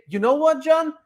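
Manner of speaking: lifted, bright opener to the customer; upbeat rep. Shown as a demonstration. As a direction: sound glad to help John. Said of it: "what you say".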